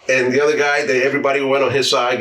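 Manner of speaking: ordinary delivery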